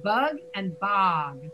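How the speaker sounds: "Bug and bog" slow, "bug" with a short "uh", "bog" with an open "ah"; slow minimal pair. Shown as a demonstration. Teacher correcting the student's vowel. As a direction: stretch out the two vowels. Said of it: "A little fix".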